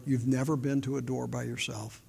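low, soft reassurance with long pauses; gently assure, sentence by sentence; Spirit speaking inwardly. Retold or imitated. The Spirit consoling a lonely soul winner, softly reassuring. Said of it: "the Spirit spoke into my heart".

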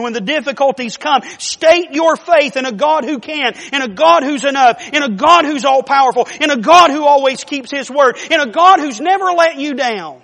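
loud, rising preacher's crescendo on each "in a God"; full-force preaching. Acted. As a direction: hammer each line home to the congregation.

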